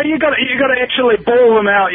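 ordinary talk, nothing marked

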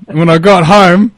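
usual speaking voice

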